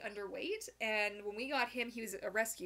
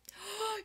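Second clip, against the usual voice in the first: excited gasp; 11 semitones higher than usual. Real, not acted. Sudden excitement over movie.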